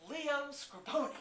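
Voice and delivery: high, loud, stretched-out name announcement; slow theatrical proclamation; grand declaration. Retold or imitated. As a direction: declare it to the whole newsroom. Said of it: "would stand up and declare".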